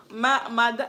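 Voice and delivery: put-on Nigerian-accented "ma". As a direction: mimic a Nigerian seller's form of address. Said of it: "in that Nigerian accent".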